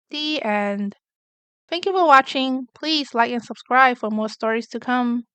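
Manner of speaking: ordinary delivery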